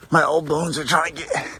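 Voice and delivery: winded from the steep uphill; panting a little between words; out of breath. Real, not acted. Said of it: "Sorry, I sound like I'm out of breath".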